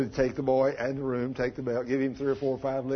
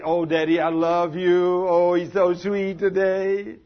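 put-on high, slow syrupy little boy; butter up daddy with a high sugary voice; wheedling child, louder and higher; sweet-talking boy; 4 semitones higher, 6 dB louder, slower than usual.

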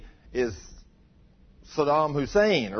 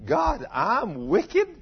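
rising higher and louder in disbelief, stretching out wicked; shocked disbelief; 7 semitones higher, 3 dB louder, much slower than usual. Retold or imitated. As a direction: ask it as if you can't believe your ears. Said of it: "He thought".

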